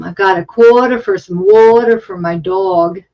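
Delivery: Boston accent. Shown as a demonstration. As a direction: do an amateur Boston accent with broad vowels. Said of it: "I can do Boston".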